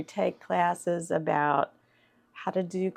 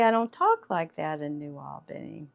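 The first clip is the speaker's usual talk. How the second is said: ordinary talk, nothing marked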